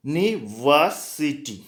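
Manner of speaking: University drawn out slowly, each syllable spaced; slow demo word. Shown as a demonstration. Teacher showing the class how it sounds, slow and deliberate. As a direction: spell out university by ear, slowly. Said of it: "pronunciation कैसे होता है?".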